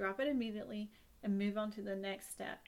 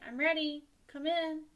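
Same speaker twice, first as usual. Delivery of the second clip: calling out; 6 semitones higher, 5 dB louder than usual. Acted. Saying the invitation words.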